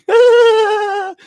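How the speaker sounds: squealing burst of laughter; loud high laugh. Real, not acted. Laughing at the fart punchline.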